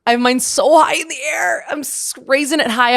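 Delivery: high, loud and gleeful. Real, not acted. Hand up for the ADHD queens, high and gleeful. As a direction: show off proudly.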